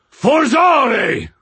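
high, loud, drawn-out battle shout; full-force incantation hurled high and long. A narrator's reading. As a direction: bellow the spell word, stretching it out. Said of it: "and shouted".

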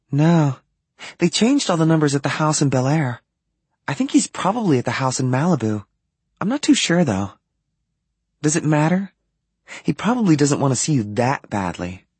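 put-on light girlish read of the line; lightly voiced young woman. A narrator's reading. Narrator voices Blair on phone.

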